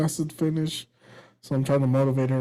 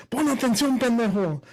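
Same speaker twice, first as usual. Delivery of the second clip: higher-pitched mock yell of a fed-up dad; 7 semitones higher than usual. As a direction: imitate a dad losing patience over homework.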